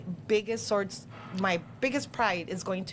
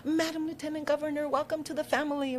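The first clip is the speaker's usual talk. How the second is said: son's formal greeting; 7 semitones higher than usual. Retold or imitated. Ten-year-old son greets official.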